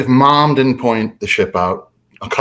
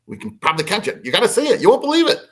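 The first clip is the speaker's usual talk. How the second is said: excited urging; 8 semitones higher, much faster than usual. Retold or imitated. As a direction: pitch climbing with excitement. Retelling urging brother to hurry.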